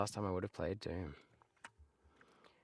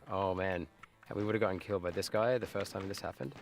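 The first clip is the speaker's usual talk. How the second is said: normal talking voice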